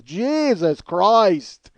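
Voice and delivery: exasperated oath. Retold or imitated. Neighbor fed up on the stairs, long exasperated groan of words. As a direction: stretch each word in mock despair. Oscar fed up with slow climb.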